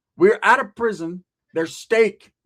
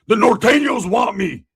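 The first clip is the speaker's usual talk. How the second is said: panicked shout; 4 semitones higher, 7 dB louder than usual. Retold or imitated. Panicked Roach on phone.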